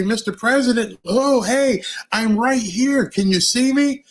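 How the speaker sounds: high, eager little voice calling out; a young girl trying to get the president's attention; hopeful girl voice. Retold or imitated. A girl reaching out to the president, high and eager.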